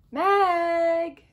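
loud call of a name. Acted. Shell calling out loud for missing Meg. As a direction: shout across the store for her.

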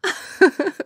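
high, loud laugh after a sprint; giddy panting laugh; breathless laugh. Acted. Laughing after running home.

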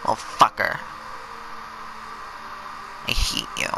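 breathy unvoiced hiss of hate; whispered hate. Real, not acted. To the dead eel boss, a whispered grudge. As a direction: mutter it under the breath.

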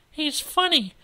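high, loud character voice; high-voiced gossip; Pauline's girlish voice. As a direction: pitch it way up like a chatty girl.

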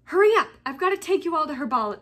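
loud, high, rapid command; barked order. A narrator's reading. Snape herding the class to herbology, barking. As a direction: bark it over a class's heads. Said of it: "barked Snape over the class's heads".

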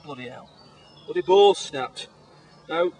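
ordinary talk

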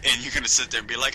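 ordinary talk, nothing marked

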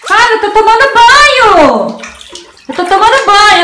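high shouted complaint; loud bath-time protest. Pretend. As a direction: holler to be left alone. Protesting during bath amid dogs.

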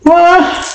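sharp pained yelp. Real, not acted. Onion burning her eyes.

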